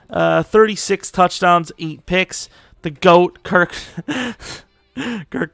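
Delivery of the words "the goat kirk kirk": cracking up mid-name; real laughing. Real, not acted. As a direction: try to say it straight and break into laughter. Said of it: "couldn't even say it without laughing".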